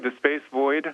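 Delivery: normal talking voice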